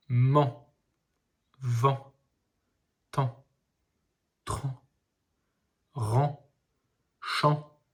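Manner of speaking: each syllable said slowly and clearly, one by one; deliberate syllable drill; reading syllables aloud. Shown as a demonstration. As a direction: read out a list of sounds for a child. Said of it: "Nous allons lire d'abord les syllabes".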